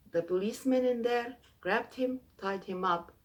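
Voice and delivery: ordinary delivery, nothing marked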